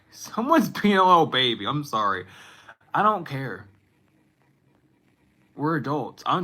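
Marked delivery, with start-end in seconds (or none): 0.1-1.6 laughing it out, louder and higher; giggling mock teasing. Real, not acted. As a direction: crack up while repeating it. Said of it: "I don't know why I said it like that".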